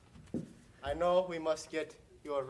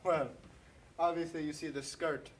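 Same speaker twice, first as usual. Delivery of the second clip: ordinary talk, nothing marked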